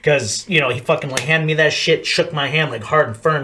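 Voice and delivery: ordinary delivery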